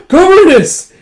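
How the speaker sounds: very high, loud acted shriek; horrified cry. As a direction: shriek the name in mock horror. Crying out at a shattered statue.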